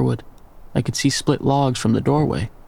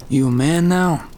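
mock him like he's still a boy; sneering old man; 4 semitones higher than usual. A narrator's reading. The old man sneers at his son's defiance. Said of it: "The sneer was still there".

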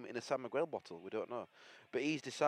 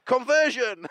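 loud high conversion call; mock commentator shout; 11 semitones higher, 10 dB louder, slower than usual. Real, not acted. Mock rugby call on kick.

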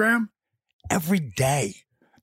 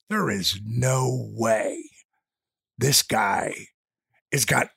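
slow doubting; 5 semitones lower, much slower than usual. Retold or imitated. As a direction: lower and drawn out very slowly. Bonnie's doubtful thought quoted.

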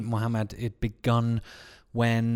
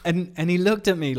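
normal talking voice

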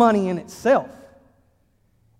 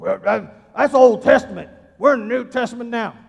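louder, put-on voice of a smug objector; mock objecting churchgoer; 2 semitones higher, 7 dB louder than usual. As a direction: sneer it like a know-it-all in the pews. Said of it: "Some of you go".

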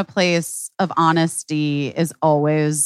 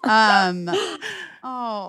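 crying; 7 semitones higher than usual. Real, not acted. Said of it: "I'm gonna cry again".

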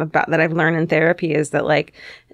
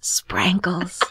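hushed secret-password whisper; very quiet whisper; 5 dB quieter than usual. Shown as a demonstration. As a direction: whisper it like a secret code word. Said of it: "you whisper very quietly".

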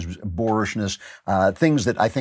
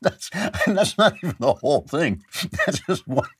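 talking through laughter, higher pitched; laughing; 6 semitones higher than usual. Real, not acted. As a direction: chuckle through the words, amused by the clip. Laughing after playing the mashup clip.

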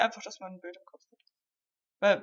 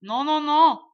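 put-on rising, louder American accent protest; american accent german; 4 semitones higher, 8 dB louder, slower than usual. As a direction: deny it in a hammy American accent. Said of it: "Ist das gerade ein amerikanischer Akzent?".

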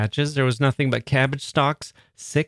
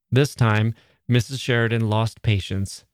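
plain reading voice

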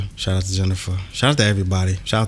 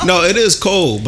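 emphatic; 10 semitones higher, 4 dB louder than usual. Real, not acted. Insisting it's cold.

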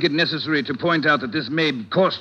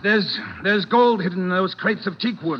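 panicked blurt; 6 semitones higher than usual. Acted. Suspect blurts the secret.

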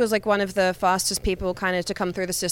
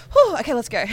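relieved exclamation; 6 semitones higher, 5 dB louder, faster than usual. Retold or imitated. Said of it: "I was like".

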